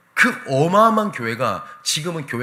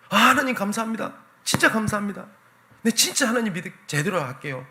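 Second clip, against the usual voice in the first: relieved thanks; 9 semitones higher than usual. Retold or imitated. Saved man thanks God.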